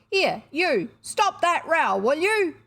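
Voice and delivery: loud, high guard's shout; a firm jailer's shout. A narrator's reading. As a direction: bark it out like a warder. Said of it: "a firm voice shouted".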